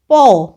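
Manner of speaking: पौ said loud and clear with a long open au vowel; clear au sound. Shown as a demonstration. Teacher sounding out पौ for pupils, loud and clear. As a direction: stretch out the au in पौ for the class. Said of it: "तो प का उच्चारण".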